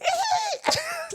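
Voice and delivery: high shrieking burst of laughter. Real, not acted. Howling at coworker's remark.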